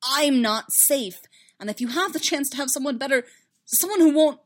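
pleading and insistent, cut short; desperate. A narrator's reading. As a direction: press the warning on her, voice high. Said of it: "a little desperate to make her understand".

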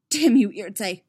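loud, bitter curse. Acted. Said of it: "Emil said bitterly".

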